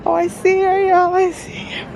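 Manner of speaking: excited. Real, not acted. Vlogger finding her cousin's stall, high giddy excitement. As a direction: call it out bursting with excitement.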